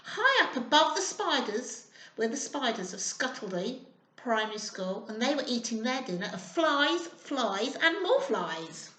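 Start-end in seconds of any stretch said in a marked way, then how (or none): none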